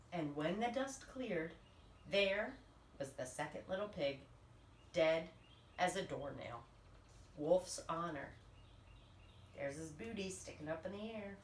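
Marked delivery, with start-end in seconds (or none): none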